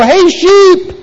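high-pitched shepherd's call; playful high call to animals. Acted. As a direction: call the flock in for dinner. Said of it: "she'll call the sheep".

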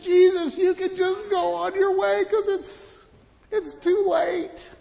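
high, loud mourner pleading. Acted. Mourners telling Jesus to leave, high whiny voice. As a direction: whine it like a grieving neighbor.

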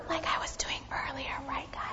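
very soft whispered aside. Shown as a demonstration. Pastor showing children how prayer can sound, whispered. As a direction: drop right down into a whisper. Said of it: "sometimes it's in a whisper".

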